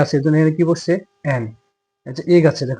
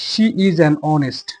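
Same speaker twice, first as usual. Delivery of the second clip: slow example read; much slower than usual. Shown as a demonstration. Teacher reads an example to students, very slow and deliberate. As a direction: read the example sentence very slowly, word by word. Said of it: "যেমন".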